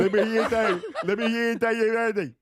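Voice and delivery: goofy voice. Pretend. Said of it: "I'm trying different voices".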